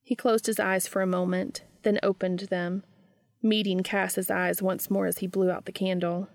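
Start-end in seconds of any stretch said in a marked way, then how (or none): none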